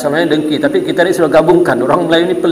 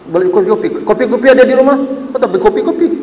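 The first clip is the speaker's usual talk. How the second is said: mock irritated husband; 8 semitones higher than usual. Husband snapping at wife.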